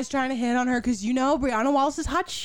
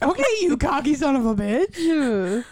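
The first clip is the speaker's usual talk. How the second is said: mock sarcastic retort; 4 semitones higher, 5 dB louder, slower than usual. Mocking Alex's reply.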